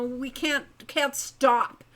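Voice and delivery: ordinary delivery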